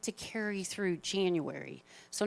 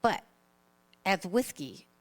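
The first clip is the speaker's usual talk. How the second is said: lightly lisped cartoon accent, halting delivery; Elmer Fudd accent; 2 semitones lower, slower than usual. Speaker telling a joke to the audience, tentative lisping cartoon accent. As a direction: attempt a cartoon lisp, s sounds turned to th. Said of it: "do my best with this, uh, accent".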